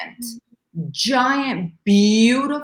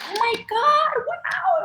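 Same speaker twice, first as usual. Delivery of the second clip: much higher, awestruck, slowing on the words; amazed excitement; slower than usual. Retold or imitated. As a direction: let the wonder burst out as the fish jumps. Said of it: "and we all were like".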